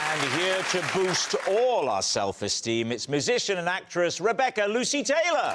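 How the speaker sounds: upbeat TV host introduction; show host intro. Acted. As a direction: announce the guests with energy.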